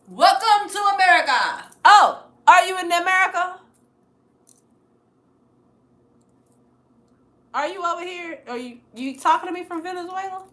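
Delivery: excited welcome. Real, not acted.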